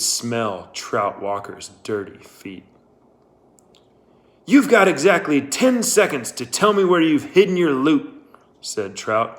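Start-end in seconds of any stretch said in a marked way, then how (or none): 4.4-8.1 loud, higher menacing demand; threatening. Acted. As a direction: bark the threat down the rifle. Trout threatening with a rifle.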